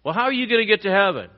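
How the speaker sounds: high, loud, fast questioning; loud challenge. Retold or imitated. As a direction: press him hard. Challenging the believer again.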